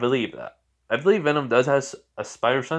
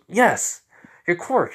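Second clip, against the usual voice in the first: higher, louder insistent reply; emphatic confirmation; 6 semitones higher, 4 dB louder, slower than usual. Acted. Creature confirming the boy's quirk, emphatic. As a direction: answer firmly, pitched up.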